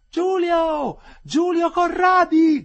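very high, long-drawn yell; woman crying out. A narrator's reading. Woman calling a prisoner by name, shouting. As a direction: call his name out at full voice. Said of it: "gridò una voce".

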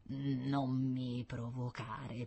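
slow, soft, whiny plea; a scientist whining at a stubborn boy; whimpering plea. Acted. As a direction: whimper it, begging not to be pushed. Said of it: "piagnucolò".

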